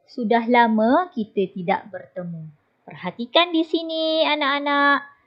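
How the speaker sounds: ordinary talk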